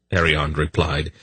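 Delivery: ordinary delivery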